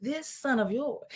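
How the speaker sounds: "This" held long and quiet; soft, pointed stress on "This son of yours". Shown as a demonstration. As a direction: point scornfully at the word "This". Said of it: "what the older brother has done".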